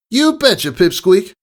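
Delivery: high, breezy, cocky tomboy voice. Acted. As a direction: toss it off with a grin. Rainbow Dash voice.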